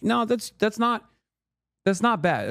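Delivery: high-pitched retold reassurance; gentle protest. Streamer answers people yelling about his wealth, high-pitched protest.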